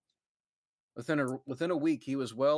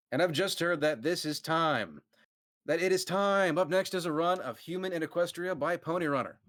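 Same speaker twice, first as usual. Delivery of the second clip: announcer read; 6 semitones higher than usual. Acted. Narrator voices the announcer.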